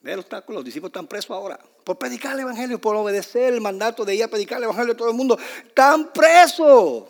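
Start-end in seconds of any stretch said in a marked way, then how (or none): 1.8-5.4 high-pitched, insistent preaching; building indignation. Real, not acted. Preacher on disciples punished for obeying, high and insistent. 5.7-7.0 high, loud, drawn-out cry; indignant shout. Real, not acted. Preacher on disciples jailed for the gospel, slow indignant shout.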